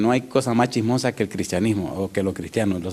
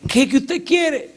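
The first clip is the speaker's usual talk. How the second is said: exasperated shout; 3 dB louder, slower than usual. Retold or imitated. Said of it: "Y me dice".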